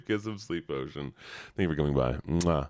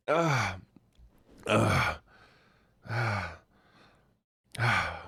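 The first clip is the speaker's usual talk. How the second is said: sour gasps; 4 semitones higher, slower than usual. Real, not acted.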